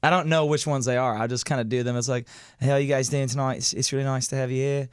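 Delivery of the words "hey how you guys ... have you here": loosely imitated, wandering British accent; shifting British accent. Shown as a demonstration. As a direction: show host greeting in an unsure accent. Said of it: "switch between three different accents".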